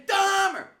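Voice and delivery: high, loud shout of the name. Retold or imitated. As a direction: bellow it again and again. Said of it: "And I'm like".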